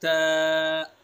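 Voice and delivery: Clean "त" from the tongue tip; short crisp ta. Shown as a demonstration. Teacher drilling students on the letter, loud clipped demo. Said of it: "इसको भी सीधा पढ़ेंगे".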